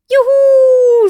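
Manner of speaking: very high, loud cheer of delight; joyful shriek. Acted. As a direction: whoop like a kid on a rollercoaster. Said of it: "schrie der Osterhase".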